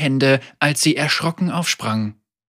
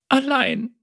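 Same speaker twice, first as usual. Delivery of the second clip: put-on tearful girl; 11 semitones higher than usual. A narrator's reading.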